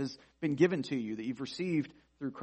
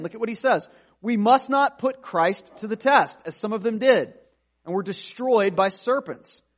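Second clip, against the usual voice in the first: raised, loud pulpit delivery; fervent sermon voice, higher and louder; preaching at full force; 4 semitones higher, 7 dB louder than usual. Acted. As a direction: drive the scripture home to the congregation. Preaching Israel's grumbling at full force.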